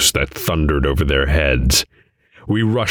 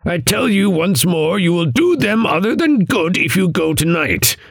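high-pitched, urgent insistence; insistent; 12 semitones higher than usual. A narrator's reading. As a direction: press the warning hard in a high voice. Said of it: "he insisted".

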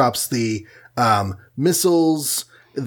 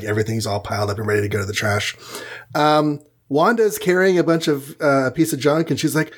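ordinary delivery

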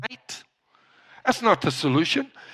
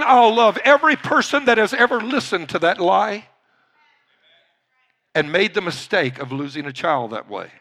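normal talking voice

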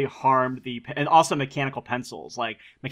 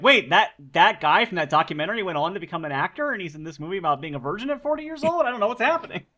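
confused townsperson; 6 semitones higher than usual. Retold or imitated.

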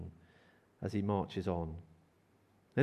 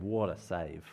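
low, softer marvelling at a save; lowered voice of appreciation; admiring praise; 9 semitones lower, 7 dB quieter, slower than usual. Shown as a demonstration. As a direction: murmur it in satisfied admiration. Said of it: "and going".

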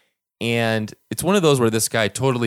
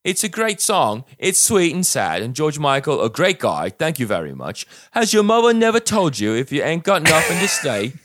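comment read as an outraged Cockney; Cockney accent; 5 semitones higher than usual. Acted. Reading Princess Delilah's online comment aloud, Cockney accent. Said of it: "an English Cockney accent".

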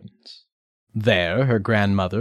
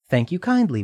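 put-on young girl voice; 8 semitones higher, faster than usual. Redcap answers the wolf.

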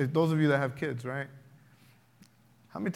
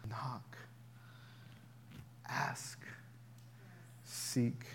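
hushed whisper; 4 semitones lower, 15 dB quieter, much slower than usual. Acted. Quoting Jesus, hushed.

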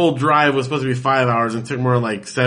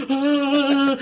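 startled scream; 13 semitones higher than usual. Retold or imitated. Everyone startled by the foghorn.

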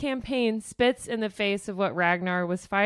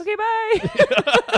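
breezy cowardly bye; 13 semitones higher, 6 dB louder, much slower than usual. Pretend. Her own cowardly exit.

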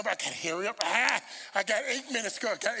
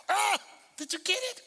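excited preaching; 8 semitones higher than usual. Real, not acted. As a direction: burst out to rouse the congregation. Preaches at full force.

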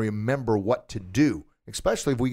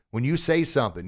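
ordinary delivery

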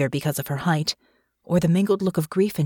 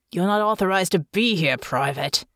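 a hint of a gruff policeman's growl. A narrator's reading. Policeman stopping Natasha, a light growl. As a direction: mildly warn her off. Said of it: "one of the policemen growled".

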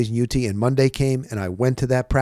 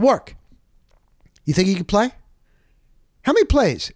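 mock challenge, pitched up; teasing question; 6 semitones higher than usual. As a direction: needle him with a grin. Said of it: "and I said".

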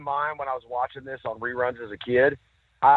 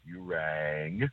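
low, slow butler rumble; deep Lurch voice; 10 semitones lower, 9 dB quieter than usual. Retold or imitated. Co-host imitates the butler answering the door, deep voice. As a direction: drop right down deep and quiet. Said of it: "say it in that deep voice like that".

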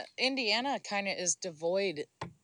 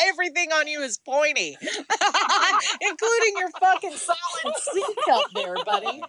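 high, loud, laughing through the insults; helpless laughter breaking into the words; laughing roast; 8 semitones higher, 4 dB louder than usual. Real, not acted. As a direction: crack up while piling on insults. Roasting a friend while cracking up.

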